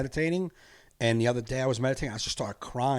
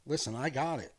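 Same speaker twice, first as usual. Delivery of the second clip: softer, slow, calm reassurance; calm reassuring God voice; 5 dB quieter, slower than usual. Retold or imitated. The meditation voice telling him to stop worrying, soft and calm. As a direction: soothe him like a patient father. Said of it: "the meditation was like".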